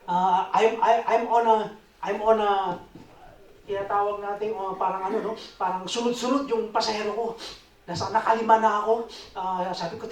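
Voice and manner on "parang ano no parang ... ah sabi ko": normal talking voice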